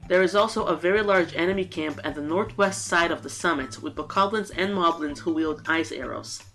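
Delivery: ordinary delivery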